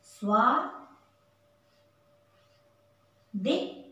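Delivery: स्वा then दि, said separately; syllable by syllable. Shown as a demonstration. Teacher to children: स्वादिष्ट split into syllables. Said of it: "स में व मिला हुआ".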